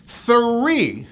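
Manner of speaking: three, carefully blended. Shown as a demonstration. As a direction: say three with clear TH and hard R. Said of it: "Now you put it all together".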